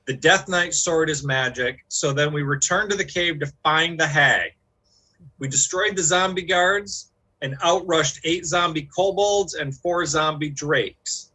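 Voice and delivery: ordinary delivery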